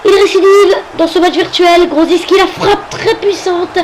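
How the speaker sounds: high, loud goal commentary; excited goal call. Acted. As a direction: shout the goal as it goes in. Calling a goal in match.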